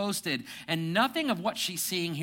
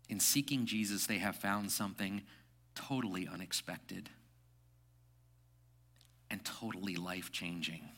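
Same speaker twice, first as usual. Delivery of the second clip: hushed; 6 semitones lower, 9 dB quieter than usual. Real, not acted. Quiet, reverent climax.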